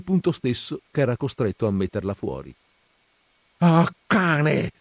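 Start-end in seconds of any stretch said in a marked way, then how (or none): 3.6-4.7 loud, furious outburst; raging cry; angry shout. A narrator's reading. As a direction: yell it at the coward. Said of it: "urlò Renzo".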